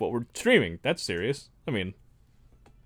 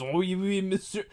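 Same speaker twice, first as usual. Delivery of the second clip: mock French; 6 semitones higher than usual. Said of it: "Also French".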